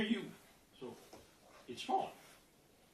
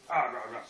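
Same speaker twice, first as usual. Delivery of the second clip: loud, lower mock "rah rah" babble; gibberish grumbling; 5 semitones lower, 8 dB louder than usual. Little block told to say its thing, loud grumbling gibberish. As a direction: blurt out nonsense grumbling.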